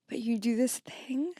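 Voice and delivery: whispered tease. Real, not acted. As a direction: lean in and whisper it. Teasing whisper.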